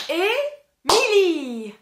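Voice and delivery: Émilie very slow and loud, one beat per syllable; slow loud clapping Émilie; clapped syllables. Shown as a demonstration. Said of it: "taper dans les mains et voir combien j'ai de syllabes".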